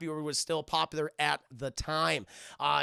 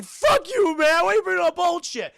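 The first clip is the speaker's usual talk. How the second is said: high, loud indignant yell; acted angry outburst; 11 semitones higher, 9 dB louder than usual. John answering the demon's taunt, acted angry outburst. John snaps back in retold quarrel.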